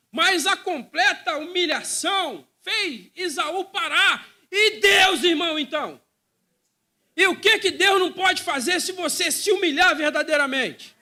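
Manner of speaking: loud, high, impassioned preacher; preaching at full force. Acted. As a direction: shout the sermon to the congregation. (pregação inflamada, em alta voz)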